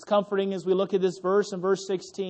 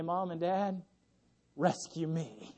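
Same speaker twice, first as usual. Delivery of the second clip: put-on helpless child; 5 semitones lower, 11 dB quieter, slower than usual. Kids finally call for parents.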